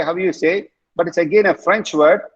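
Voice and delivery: ordinary talk, nothing marked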